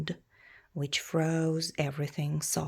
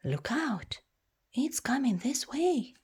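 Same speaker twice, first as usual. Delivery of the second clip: higher, urgent warning call; alarmed warning; 6 semitones higher than usual. Acted. Villager warning others of a dragon, mild alarm. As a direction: warn them, mildly alarmed. Frightened villager speech bubble.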